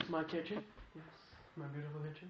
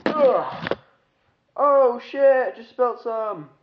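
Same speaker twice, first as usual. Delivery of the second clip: loud sudden dismay; startled outburst; 9 semitones higher, 14 dB louder, much slower than usual. Real, not acted. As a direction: blurt it out in alarm. Cinnamon spilled, loud exclamation.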